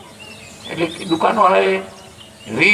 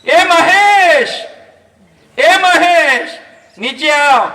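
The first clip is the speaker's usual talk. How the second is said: loud, high, drawn-out call upstairs; 7 semitones higher, 5 dB louder, much slower than usual. Retold or imitated. Wife calling her husband down from upstairs, loud high call. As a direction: holler the name up the stairs. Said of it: "એને બૂમ પાડી".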